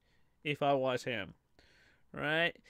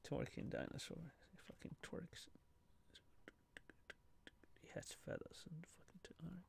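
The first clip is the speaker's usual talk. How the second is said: hushed, breathless whispering; 3 semitones lower, 26 dB quieter, faster than usual. Real, not acted. Marvelling at the twerking dinosaur, real whisper. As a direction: drop to a secret whisper.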